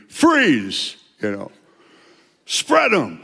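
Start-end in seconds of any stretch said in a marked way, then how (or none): 0.1-1.0 sharp, high, loud police order; cop command. Retold or imitated. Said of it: "cops come up to me". 2.5-3.2 sharp, high, loud police order; cop command. Retold or imitated. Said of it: "cops come up to me".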